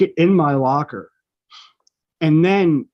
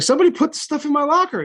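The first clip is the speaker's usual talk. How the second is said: fast, higher, rushed protest, imitated; agitated teen; 10 semitones higher, much faster than usual. Student protesting to the disciplinarian, rushed and higher. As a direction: blurt it out like a flustered kid.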